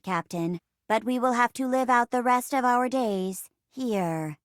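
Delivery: even, measured robot computer voice; robot assistant. Acted. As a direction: report like a ship's computer, evenly paced.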